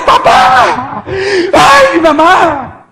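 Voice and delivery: high, loud wailing cry; drawn-out tearful yell; screaming for parents. Retold or imitated. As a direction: scream out for help in agony. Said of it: "Y empecé a gritar".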